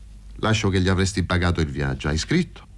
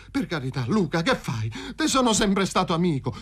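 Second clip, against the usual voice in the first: pleading; 10 semitones higher than usual. Acted. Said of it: "prese a scongiurare Paroni". (supplica spaventata)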